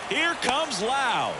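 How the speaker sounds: ordinary talk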